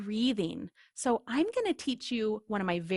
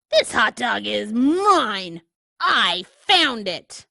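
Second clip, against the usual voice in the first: slow, louder insistent pigeon; possessive pigeon; 8 dB louder, slower than usual. Acted. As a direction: plant your claim firmly. Pigeon claims the hot dog.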